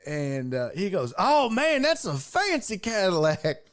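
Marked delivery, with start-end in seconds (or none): 1.2-3.7 high, wowed imitation; admiring country guy. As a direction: gush over it like an impressed country boy. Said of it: "it was kind of that vibe".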